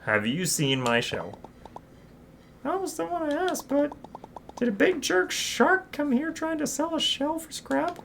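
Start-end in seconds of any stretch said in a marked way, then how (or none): 2.6-8.0 put-on high, eager young crab voice; high-pitched kid crab; young crab Krill. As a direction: play the small hero, bright and curious.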